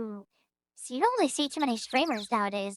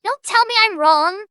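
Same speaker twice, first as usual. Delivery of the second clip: loud, higher shout; full-volume mock defiance; defiant shout; 6 semitones higher, 11 dB louder than usual. Defiant outburst in banter.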